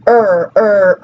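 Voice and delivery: drawn-out "er, er", held as a demo sound; demonstrating er sound. Shown as a demonstration. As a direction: voice the "er" alone, like showing a sound to students. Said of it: "I can make the schwa".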